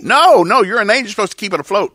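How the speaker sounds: [name] objecting in disbelief; mock protest.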